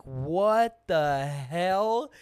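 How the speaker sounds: slow, drawn-out disbelief; baffled what the hell. Retold or imitated. Telling friends about the drunk guy, drawn-out baffled disbelief. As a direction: stretch it out like you can't believe your eyes. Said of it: "And I was like".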